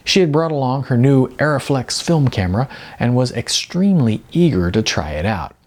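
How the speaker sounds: ordinary delivery, nothing marked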